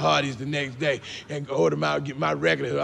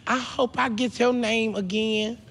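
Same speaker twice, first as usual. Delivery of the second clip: little niece; 6 semitones higher than usual. Acted. Little niece to her uncle at Christmas, childlike and hopeful. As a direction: pitch it up like a small child. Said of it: "little niece looked at me and said".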